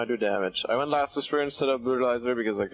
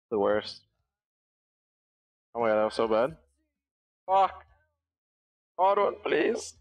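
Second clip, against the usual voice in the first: ordinary talk, nothing marked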